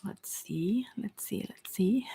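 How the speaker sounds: breathy whisper to herself while studying the cards; hushed thinking aloud; whispered musing. Real, not acted. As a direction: murmur it under your breath, thinking. Reader studying cards murmurs to self.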